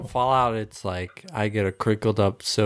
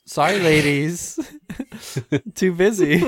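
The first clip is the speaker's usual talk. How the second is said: smug swagger; 6 semitones higher, 3 dB louder than usual. Acted. Mock ad, swaggering line.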